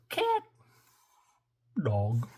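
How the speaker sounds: ordinary delivery, nothing marked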